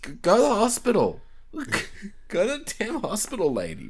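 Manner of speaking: exasperated rant. Real, not acted. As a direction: beg the screen in exasperation. Ranting at a film character.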